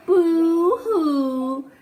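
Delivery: put-on play-cry for the kids; hammed-up boo-hoo; mock boo-hoo. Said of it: "Miss Spider is not happy at all".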